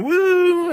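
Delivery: very high, loud crowd whoop; mock crowd woo from a fan; imitating crowd woo. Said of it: "The crowd was going".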